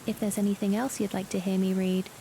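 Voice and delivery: ordinary delivery, nothing marked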